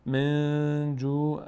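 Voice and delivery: slow level chant, من جوع with the n hummed through the nose; nasalised hidden n. Shown as a demonstration. Teacher demonstrates ikhfa, nasalised hidden n. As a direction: hold the n of من in the nose, then draw out جوع.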